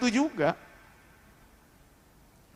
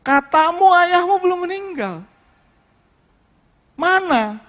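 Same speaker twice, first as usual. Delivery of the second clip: higher, slow, accusing scold; scolding mother; 9 semitones higher, 5 dB louder, slower than usual. Retold or imitated. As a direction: blame him slowly and bitterly, voice up high. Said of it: "saya yang dimarahin". (ibu memarahi anaknya, nada tinggi dan lambat)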